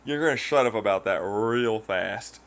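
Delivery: usual speaking voice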